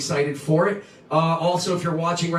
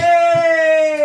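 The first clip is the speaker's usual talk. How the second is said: cheering; 13 semitones higher, 7 dB louder than usual. Real, not acted. Cheering the story.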